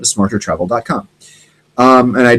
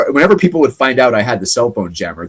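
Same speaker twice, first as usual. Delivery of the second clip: usual speaking voice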